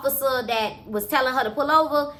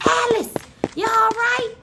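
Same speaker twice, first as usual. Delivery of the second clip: high-pitched worried call-out; alarmed call; 10 semitones higher than usual. Acted. Friend calling to Alice at the stairs' foot, alarmed. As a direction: call down to a friend who has fallen.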